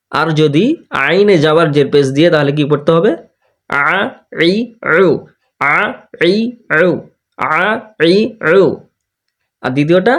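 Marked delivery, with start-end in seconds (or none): 3.7-8.6 slow teacher chant of the vowels; vowel drill. Shown as a demonstration. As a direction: call out each vowel for pupils to repeat. (শিক্ষকের মতো স্বরধ্বনি অনুশীলন)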